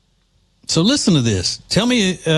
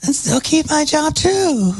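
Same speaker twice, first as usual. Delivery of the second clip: put-on much higher, childlike echo; mock little girl voice; 12 semitones higher, slower than usual. Echoing her line in a creepy doll voice. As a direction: mimic a possessed doll. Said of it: "talking like a little possessed doll".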